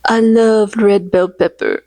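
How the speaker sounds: slow, lightly voiced meme line; a hint of a mocking meme voice; meme quote. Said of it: "reference the meme".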